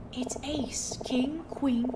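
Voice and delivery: breathy secret whisper. Acted.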